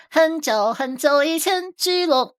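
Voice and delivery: high, slow fairy-tale opening; mock storyteller. Game guide host jokingly turns a card name into a fairy tale, mock storyteller.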